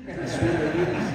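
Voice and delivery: high, loud embarrassed laugh through the words. Real, not acted. Brother at sister's rededication, laughing off his nerves. Nervous speaker blames the mic.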